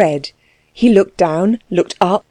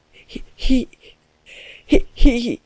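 struggling to speak; 4 semitones higher than usual. A narrator's reading. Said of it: "struggling to get the words over his dry lips".

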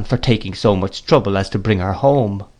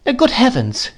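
much higher, distressed exclamation; crying out; 13 semitones higher, slower than usual. A narrator's reading. Mother cries out at Peggotty, higher and distressed. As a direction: cry out in dismay. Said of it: "cried my mother".

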